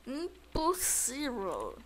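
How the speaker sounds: very slow, stretched-out word; slow drawn-out disbelief. Real, not acted. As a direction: drag every syllable in disbelief. Repeats, slowly drawn out. (bem arrastado e lento)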